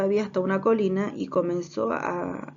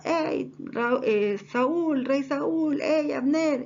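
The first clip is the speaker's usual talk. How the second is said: higher, acted hailing shout; calling out; 6 semitones higher, much slower than usual. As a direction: call the names out as if across a distance. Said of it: "Entonces gritaba".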